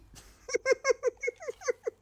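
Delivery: very high-pitched laugh; long squeaky giggle of delight. Real, not acted. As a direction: giggle helplessly, high and giddy. Tickled by the name Hooky.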